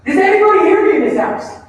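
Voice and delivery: loud, high, fast parent outburst; exasperated parent. Acted. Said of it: "if you're a parent".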